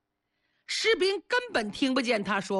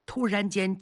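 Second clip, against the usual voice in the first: ordinary delivery, nothing marked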